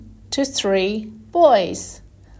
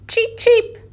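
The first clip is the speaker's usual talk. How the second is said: chick cheep; 12 semitones higher, 5 dB louder than usual. Shown as a demonstration. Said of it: "said the cat".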